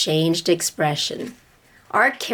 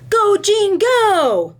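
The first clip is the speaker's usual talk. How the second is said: high, louder shout of encouragement; cheering call; 13 semitones higher, 4 dB louder, slower than usual. Acted. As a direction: cheer her on from the side. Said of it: "a kid called out".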